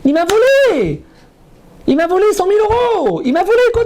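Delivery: high, loud imitated complaint; mock outraged victim. Rabbi imitating a congregant furious about stolen money, mock outrage. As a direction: play the man crying he was robbed. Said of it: "il m'a pris de l'argent".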